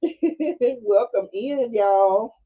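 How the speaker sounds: slow, drawn-out welcome through laughter; giggling teller impression; laughing mock greeting. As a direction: stretch the welcome out while laughing.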